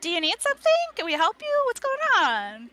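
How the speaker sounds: high, loud, eager greeter; put-on chirpy host voice, pitched well up; Young Poems. Young book welcoming visitors to the library, eager. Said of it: "turns back to you and says".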